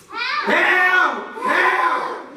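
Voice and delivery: high, loud cry for help; mama crying for help. Acted. Mama screaming from the car window. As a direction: shout it like a panicked mother. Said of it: "you know what she said".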